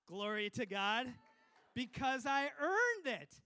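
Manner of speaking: loud, slow preacher's cry; triumphant praise. Real, not acted. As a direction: proclaim it to the crowd.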